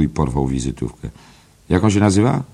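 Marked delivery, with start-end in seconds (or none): 1.7-2.4 eager question. Acted. Mulligan grabs the card.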